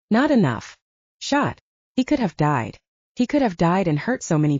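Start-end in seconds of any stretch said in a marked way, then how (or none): none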